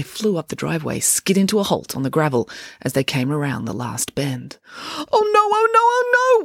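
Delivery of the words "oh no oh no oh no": very high, loud panicked wail. A narrator's reading. Dad seeing the house on fire, high wail. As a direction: howl it in despair. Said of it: "wailed Dad".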